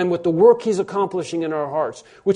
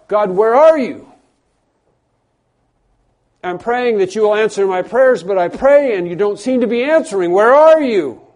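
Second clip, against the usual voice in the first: loud, high, frustrated plea; shouting at God; 4 semitones higher, 7 dB louder than usual. Acted. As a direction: cry out demanding an answer from God. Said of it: "And we shout at God".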